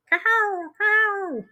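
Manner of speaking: high, drawn-out cahow bird call; bird impersonation. Shown as a demonstration. Host demonstrating the cahow's call to co-host, bird impersonation. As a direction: call out "cahow" like a seabird, far above your speaking pitch. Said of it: "that's my bird impersonation".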